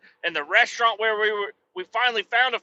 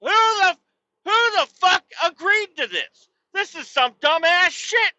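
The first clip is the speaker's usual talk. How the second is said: raised, loud exasperated rant; high loud furious tirade; fired-up rant; 8 semitones higher, 5 dB louder than usual. Real, not acted. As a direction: erupt in outrage at the city. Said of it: "I'm getting all fucking fired up".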